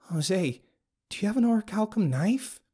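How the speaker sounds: high, intent female character voice; alarmed woman's question. A narrator's reading. Abigail holds the sizzling knife and questions narrator, alarmed. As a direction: ask it high and uneasy.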